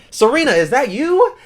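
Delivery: loud, very high surprised squeal of a question; hammed-up delighted shock; mock astonished recognition. Wiz spots someone she knows.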